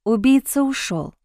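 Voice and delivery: ordinary delivery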